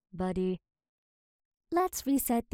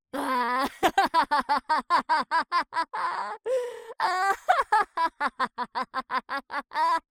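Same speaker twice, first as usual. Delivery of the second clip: long maniacal cackle; 7 semitones higher, 6 dB louder than usual. Acted. Long maniacal villain laugh.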